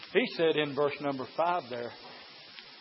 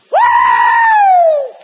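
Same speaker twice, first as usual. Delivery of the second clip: a soaring, shouted high whoop; huge high whoop; 22 dB louder than usual. Real, not acted. Preacher to congregation, trying the whoop again, very high. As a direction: let out a big high whoop. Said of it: "Let me try it one more time".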